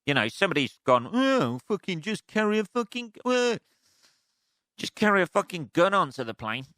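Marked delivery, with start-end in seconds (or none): 1.1-6.7 higher voice, mocking a careless traveller; hammed-up idiot traveller; mock dopey passenger. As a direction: play a clueless passenger asking to carry a gun.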